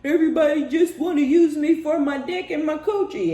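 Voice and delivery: high, loud mocking whine of a confused player; mock whiny complaint. Promiscuous person wondering why everyone uses them, high mocking whine. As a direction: whine it up high like you can't believe it. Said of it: "And they're like".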